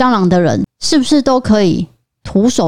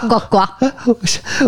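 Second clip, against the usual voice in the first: slow croaking frog; 3 semitones higher, 3 dB quieter, much slower than usual. Pretend.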